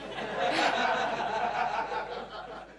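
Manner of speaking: a real burst of sheepish laughter; embarrassed laugh. Real, not acted. Speaker laughing at her own thesis joke to the audience, sheepish laugh. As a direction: laugh it off, a little caught out.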